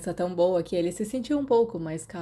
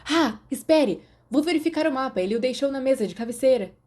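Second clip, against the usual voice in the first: higher, louder and quicker, struck by a plan; eager, hurried; sudden eager idea; 6 semitones higher, 5 dB louder than usual. Acted. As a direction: blurt it out, pleased with yourself.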